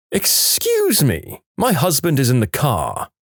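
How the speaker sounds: snooty indignant warning; haughty threat. Retold or imitated. Rude driver threatening the friend with her husband, high haughty drawl.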